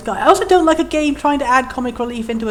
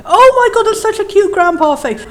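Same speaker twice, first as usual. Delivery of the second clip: delighted squeal; 6 semitones higher, 4 dB louder than usual. Real, not acted. Sees cute grandpa sprite.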